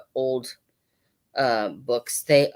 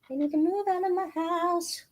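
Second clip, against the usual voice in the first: much higher, softer, squeaked out; high squeaky mock plea; 13 semitones higher, 6 dB quieter than usual. Shown as a demonstration. Seller pleading comically to viewers about the books, high squeak. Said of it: "repeats it differently".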